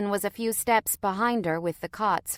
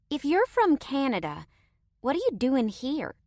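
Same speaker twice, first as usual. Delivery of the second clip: put-on higher, direct child asking; blunt question; 8 semitones higher than usual. A narrator's reading. Curious kid questioning the Canadians, blunt. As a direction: ask straight out with no tact. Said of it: "Chloe asked bluntly".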